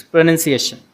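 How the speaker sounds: "pronunciation" at normal brisk speed; fast setting. Shown as a demonstration. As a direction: say it in one quick, connected go. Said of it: "if you want Google to pronounce it fast".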